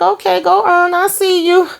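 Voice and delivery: very high, impressed hype voice; playful cheering-on. Retold or imitated. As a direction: cheer him on in a high-pitched voice.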